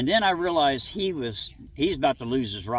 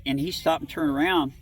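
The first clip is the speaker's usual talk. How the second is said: ordinary talk, nothing marked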